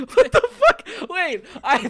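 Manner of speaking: high, loud laughing what the fuck; laughing disbelief. Real, not acted. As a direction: laugh out the outrage. Laughing at existential question.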